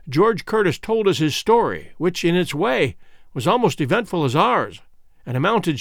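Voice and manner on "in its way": plain reading voice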